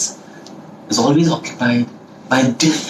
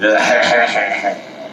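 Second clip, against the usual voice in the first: put-on old-movie villain's chuckle; long, staccato wicked laugh; 4 semitones lower than usual. Playing the old-movie villain hunter, villain laugh. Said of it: "they'll be having this look".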